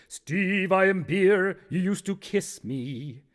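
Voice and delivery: haunt Steve as his abandoned beer. Acted. Beer comes back to haunt Steve, haunting musical voice. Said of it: "and Beer says".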